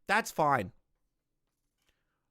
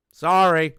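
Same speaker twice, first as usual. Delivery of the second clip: emphatic mock apology; 11 dB louder than usual. Real, not acted. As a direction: belt out a sarcastic apology.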